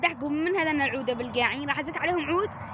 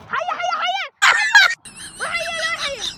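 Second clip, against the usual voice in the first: high, loud shout of snake; fake panic shout; 8 dB louder than usual. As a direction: yell high in fake alarm. Prank: shouting snake at friends.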